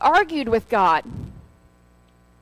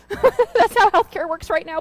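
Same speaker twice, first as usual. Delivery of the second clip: laughing aside; 8 semitones higher, 5 dB louder than usual. Real, not acted. Laughing aside about healthcare.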